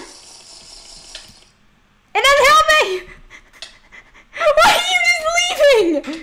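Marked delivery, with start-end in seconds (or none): 2.1-6.2 yelling in laughing panic; real shout. Real, not acted. Player yelling at the game glitching her skin, laughing alarm.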